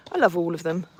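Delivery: ordinary talk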